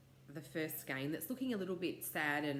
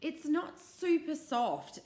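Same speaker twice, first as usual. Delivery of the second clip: slow disappointed whine; 9 semitones higher, 3 dB louder, slower than usual. Retold or imitated. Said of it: "I was like".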